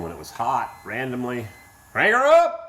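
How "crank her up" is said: high, loud cheer; excited shout. Real, not acted. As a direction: yell it like a starter's call. Starting the car.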